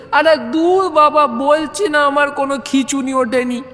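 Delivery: high, irritated outburst; exasperated protest. Acted. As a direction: snap back in frustration. Stammerer loses patience. (বিরক্ত প্রতিবাদ)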